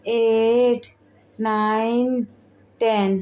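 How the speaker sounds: slow, weary count; each number dragged out with effort; worn-out counting. Acted. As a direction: count out slowly, drained by exercise. Counting through tiring exercise, exhausted.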